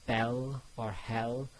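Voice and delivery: "bell or hell" said slowly, stretching the open e; open e sound. Shown as a demonstration. Teacher stretching the vowel in "bell or hell" for students. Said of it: "that type of E sound".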